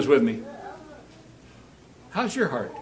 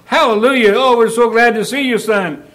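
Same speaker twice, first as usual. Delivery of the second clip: joyous welcome; 6 semitones higher, 6 dB louder than usual. Acted. Family welcoming the son home.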